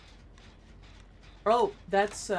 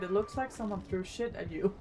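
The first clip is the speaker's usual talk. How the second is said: laughing; 8 dB quieter than usual. Retold or imitated. Retelling a rude joke, laughing.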